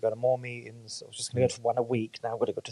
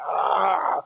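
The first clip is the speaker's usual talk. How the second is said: frustrated cry; 7 semitones higher, 4 dB louder than usual. Retold or imitated. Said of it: "I was like".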